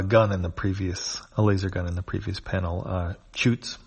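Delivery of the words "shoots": ordinary delivery, nothing marked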